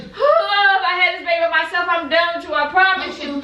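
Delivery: loud, pained outburst in labor; loud protest. Real, not acted. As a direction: raise your voice through the pain.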